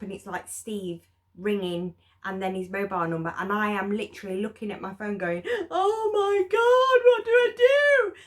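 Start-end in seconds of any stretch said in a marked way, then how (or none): 5.7-8.1 panicked squeal. Retold or imitated. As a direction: shriek it in sudden panic. Panicking at Steve's call.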